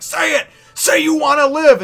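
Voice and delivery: high, full-volume yell; Luffy's shouted demand. Retold or imitated. Luffy urging a crewmate to choose life, shouting. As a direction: demand it at the top of the voice.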